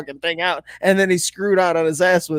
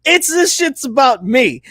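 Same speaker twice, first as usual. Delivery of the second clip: brash Les Miles; 5 semitones higher, 3 dB louder than usual. Retold or imitated. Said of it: "here's Les Miles like".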